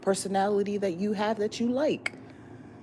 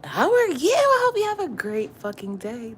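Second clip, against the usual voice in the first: hyped greeting; 9 semitones higher, 8 dB louder than usual. Acted. Vlogger hyping up viewers with the morning slogan.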